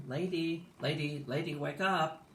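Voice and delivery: urgent waking call. Acted. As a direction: try to rouse someone, mildly acted. Nurse trying to wake sleeper.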